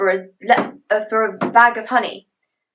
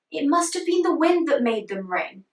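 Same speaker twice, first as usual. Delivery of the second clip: reassuring dragon mother; 6 semitones higher than usual. Acted.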